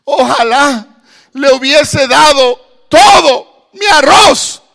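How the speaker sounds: high sobbing wail, slow; crying out. Acted. Beggar cries out in regret over his rice, high sobbing wail. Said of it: "empezó a gritar".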